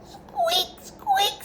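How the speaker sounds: high, small mouse squeaks. A narrator's reading. Reading to Ella as the mouse, high squeaky voice. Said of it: "says the mouse".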